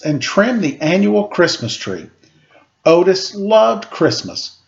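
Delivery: ordinary delivery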